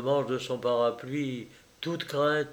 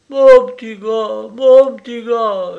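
higher, louder grieving lament; heartbroken wail; 9 semitones higher, 13 dB louder than usual. Acted. Peasant mother mourning her dead son, heartbroken wail. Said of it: "gémissait la paysanne à fendre l'âme".

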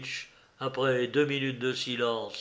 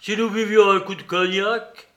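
father voice; 6 semitones higher, 6 dB louder than usual. A narrator's reading. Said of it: "dit Fedor Pavlovitch".

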